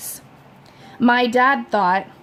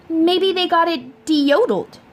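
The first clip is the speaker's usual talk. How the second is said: higher, earnest mom; mom's lightly voiced theory; mother's voice; 8 semitones higher than usual. A narrator's reading. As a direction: pipe up brightly with the idea. Mother's odd idea.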